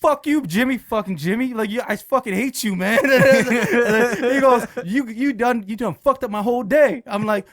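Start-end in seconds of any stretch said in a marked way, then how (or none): 0.0-3.0 higher, heated cursing; mad. Retold or imitated. Coworker learning who the prank caller was, mad. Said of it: "He was so mad at me". 4.8-7.0 high, loud aggrieved complaint; mad. Retold or imitated. Pranked coworker blaming the prankster, mad. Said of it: "He was so mad at me".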